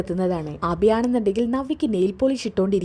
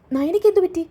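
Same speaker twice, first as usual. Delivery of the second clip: much higher and louder, alarmed; shocked question; 10 semitones higher, 6 dB louder than usual. Acted. Hears Nayana was hospitalised.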